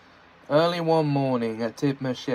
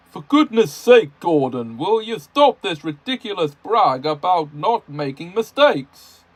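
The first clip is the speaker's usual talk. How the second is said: loud, higher stern telling-off; scolding; 4 semitones higher, 3 dB louder than usual. Acted. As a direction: scold the engine firmly. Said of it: "he scolded".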